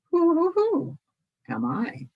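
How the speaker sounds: high owl hoots; owl hooting. Acted. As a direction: hoot like a little owl. Owl hoots mid-speech.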